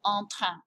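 en train with two French nasal vowels; French en train, throaty r, nasal ending; French pronunciation. Shown as a demonstration. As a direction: model en train in French, final n silent. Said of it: "Listen to that pronunciation".

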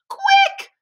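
very high, loud piglet squeal; urgent squeaky call to hurry; little pig's cry. A narrator's reading. Third little pig calls his brothers to safety, crying out. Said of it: "cried the third little pig".

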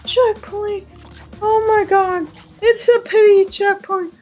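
high, whining oh my god; half-crying complaint; high whiny disbelief. Real, not acted. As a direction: whine like it's unfair. Disbelieving whine at checkpoint.

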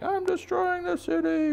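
very high, slow monster-bunny squeal; rampaging baby bunny. Acted. Baby bunny announcing its city rampage, very high squeal. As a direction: stretch it out high like a tiny Godzilla. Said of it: "baby bunny is like".

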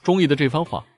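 plain reading voice

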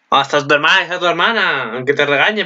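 ordinary talk

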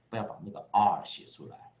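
normal talking voice

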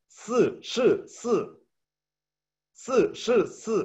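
"si, shi, si" said high and crisp; Mandarin tongue twister. Shown as a demonstration. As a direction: demo the Chinese twister slowly. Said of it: "Va de nuevo todo".

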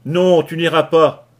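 loud, slow furious yell. Acted. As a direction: roar to stop him going. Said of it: "hurla Kelly".

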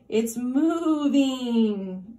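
mild shout. A narrator's reading. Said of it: "shouted Bossy Cow".

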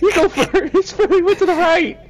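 high laughing, spooked; nervous laughing. Real, not acted. Scared, laughing, pushing friend ahead.

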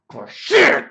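slow start, then a high loud shout; sudden yell. Real, not acted. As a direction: drag it out, then blow up. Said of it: "sudden yell at the end".

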